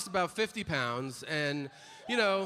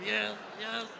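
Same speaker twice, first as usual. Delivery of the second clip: meek admission; 5 semitones higher than usual. Retold or imitated. Customer meekly admitting, soft shifted voice.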